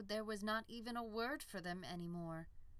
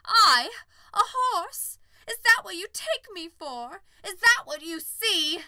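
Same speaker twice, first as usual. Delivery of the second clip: high, loud, offended shout; indignant outburst; 13 semitones higher, 20 dB louder than usual. Acted. Unicorn to the farmer, loud offended outrage. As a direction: rage at the insult.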